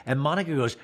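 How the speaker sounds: ordinary delivery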